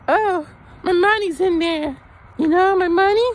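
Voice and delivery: ditzy squeaky impression of her; imitating his date. Date explaining where her money is, in a mock high falsetto. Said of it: "She's like".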